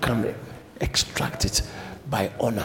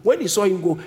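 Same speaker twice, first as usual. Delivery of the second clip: normal talking voice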